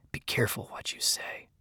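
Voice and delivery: hushed, whispered hiss; hissed warning. A narrator's reading. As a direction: warn him under your breath. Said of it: "he hissed".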